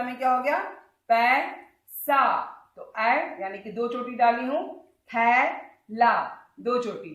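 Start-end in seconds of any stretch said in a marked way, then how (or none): 1.1-2.4 पैसा split slowly into पै, सा; slow sounded-out पैसा; syllable by syllable. Shown as a demonstration. Teacher sounds out vowel sign. 5.1-6.1 थैला split slowly into थै, ला; slow sounded-out थैला; syllable by syllable. Shown as a demonstration. Teacher sounds out vowel sign.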